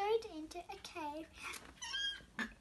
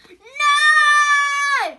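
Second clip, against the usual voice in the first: huge high cry; 9 semitones higher, 22 dB louder than usual. Acted. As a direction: scream it as you charge. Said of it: "he cried".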